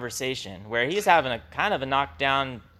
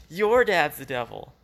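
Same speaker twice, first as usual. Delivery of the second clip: put-on childish taunt; 4 semitones higher than usual. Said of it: "Sounds like a bunch of kindergarten kids".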